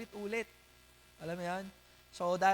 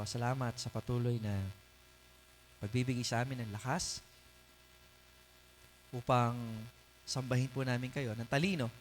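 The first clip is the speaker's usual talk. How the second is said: low, quick, steady praying voice; prayer; 7 semitones lower, faster than usual. Real, not acted. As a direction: pray aloud on the congregation's behalf, pitched low. Leads a closing prayer.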